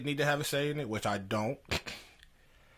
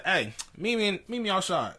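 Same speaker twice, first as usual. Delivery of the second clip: higher, heated invitation to step outside; retold heated challenge; 6 semitones higher than usual. Challenging the grad assistant to the hallway, heated. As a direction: square up to him. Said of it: "I was already heated".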